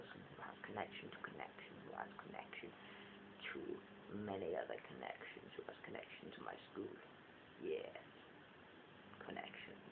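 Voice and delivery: fast fading whisper. Real, not acted. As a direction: rush it out under your breath. Trailing rambling whisper.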